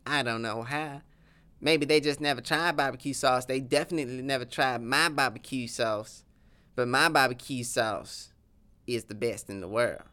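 lightly voiced Texan accent, proud of his sauce; a hint of a boastful Texas cowboy; southern accent.

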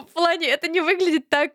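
usual speaking voice

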